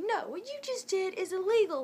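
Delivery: mock-scolding, high and loud; retold temper loss. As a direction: re-enact losing your temper. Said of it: "I lost it that time".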